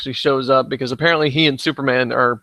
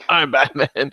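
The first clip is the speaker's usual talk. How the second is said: lightly put-on superhero voice; a hint of superhero impression; Batman; slower than usual. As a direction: repeat it as a mock hero's catchphrase. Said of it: "he's doing the Batman thing".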